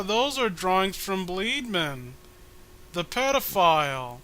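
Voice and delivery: ordinary talk, nothing marked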